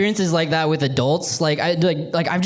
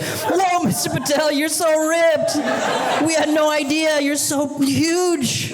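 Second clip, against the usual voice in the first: put-on high, slow, awed kid voices; amazed kids; 10 semitones higher, slower than usual. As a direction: gush like starstruck children. Kids amazed at his abs.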